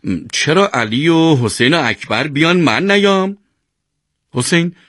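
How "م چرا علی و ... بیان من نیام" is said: higher-pitched, indignant complaint; self-righteous protest. A narrator's reading. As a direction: argue as the one who's been wronged. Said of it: "با قیافهٔ حق به جانب گفت". (اعتراض حق به جانب)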